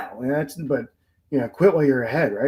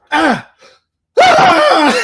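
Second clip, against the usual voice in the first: loud, high re-enacted scream; two screams, the second far higher and louder; acted startled scream; 12 dB louder than usual. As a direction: scream as if jumped in a scare prank. Acts out scream of jump scare.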